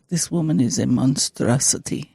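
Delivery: put-on low, quiet Russian accent; deep mock-Russian pronouncement. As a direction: imitate a disapproving Russian man, low and soft. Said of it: "The way you're talking in that voice is like Red".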